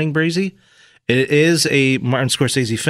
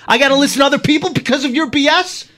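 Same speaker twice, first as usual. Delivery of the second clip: raised, indignant tough-guy tirade; angry mobster; 9 semitones higher, 3 dB louder than usual. Acted. Host acting a Casino quarrel, angry mobster. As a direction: push it high and heated, like a mob quarrel. Acted mob quarrel from film.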